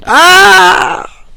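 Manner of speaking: sudden high shriek of embarrassment; embarrassed yelp. Real, not acted. Vlogger cringing at her own story, yelps. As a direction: let out a flustered shout.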